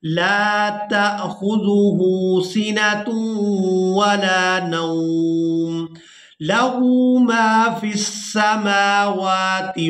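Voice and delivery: slow, melodic tilawat, drawn out; Quran recitation. Shown as a demonstration. Teacher reciting the verse to students, slow sung tilawat. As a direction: chant each word long and sung.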